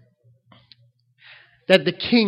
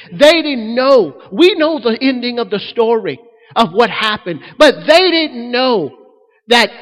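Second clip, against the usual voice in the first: louder and higher, pounding each never; preaching at full force; 5 semitones higher, 6 dB louder than usual. Acted. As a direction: preach it out to the congregation, rising.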